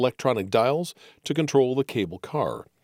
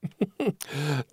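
gleeful giggle; 3 semitones higher, 5 dB quieter than usual. Acted. Edgar giggling before his quip.

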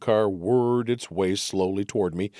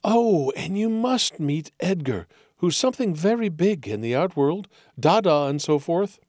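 gushing society hostess; 5 semitones higher than usual. Acted. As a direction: gush like a grand hostess. Margot introducing guests.

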